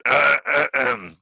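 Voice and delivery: loud theatrical throat clearing. Acted. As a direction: clear the throat before an official speech. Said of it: "clears his throat".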